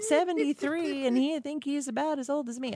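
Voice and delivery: put-on high, far-off old lady voice. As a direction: imitate the elderly lady at the salon. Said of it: "I don't know why I'm talking like this".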